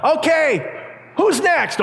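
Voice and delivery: high call from below; imitated yell. Rider calling up for the next jumper, high triumphant yell. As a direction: holler it up to the others. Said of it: "he yells up".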